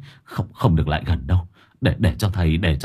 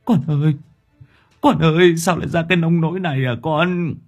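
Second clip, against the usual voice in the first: high, whimpering lament; tearful wail; 7 semitones higher than usual. A narrator's reading. Father sees his ghost child bound, tearful high wail. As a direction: wail it as if about to cry. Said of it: "Chú mếu máo".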